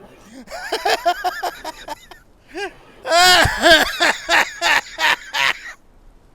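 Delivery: loud laughing fit. Real, not acted. Streamer bursts out laughing.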